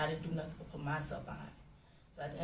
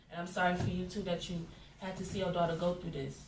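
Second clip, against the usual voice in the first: ordinary talk, nothing marked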